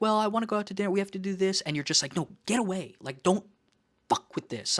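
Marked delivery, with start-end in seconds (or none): 0.0-1.6 high, whiny imitation of a friend wanting dinner; pushy friend. Friend pushing to go out for dinner, high whiny mock voice. 2.1-4.8 higher, a touch of mock snapping, the last words dropping to a hiss; irritated refusal. To a friend who breaks his routine, mock irritated refusal.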